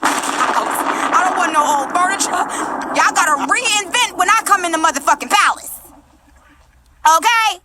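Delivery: worked-up, high-pitched rant; yelling without realising. Real, not acted. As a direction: get carried away and raise your voice. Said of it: "Am I yelling?".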